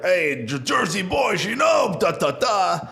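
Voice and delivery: put-on high, shouted Italian-accented crowd work; hammed-up Italian emcee working the room; shouted mock crowd work. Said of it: "He'll do crowd work and be like".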